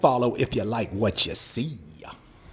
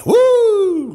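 loud high falsetto woo; loud whoop; 8 dB louder than usual. Real, not acted. Cowboy whooping over the wood for his viewers, loud and high. Hype whoop on live stream.

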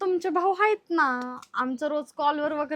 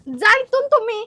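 loud, high outburst; angry shout; 7 semitones higher, 7 dB louder than usual. Acted. Sister-in-law ordering brother-in-law out, shouting angrily. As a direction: shout him out of the house.

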